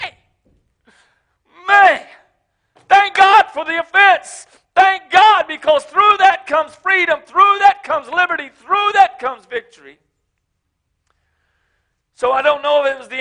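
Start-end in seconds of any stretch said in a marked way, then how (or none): 0.0-10.0 loud, high preaching shout; shouted praise. Retold or imitated. Preacher shouting thanks for the offense. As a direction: cry it out like a revival.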